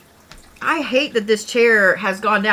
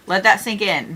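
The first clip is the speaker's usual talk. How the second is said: normal talking voice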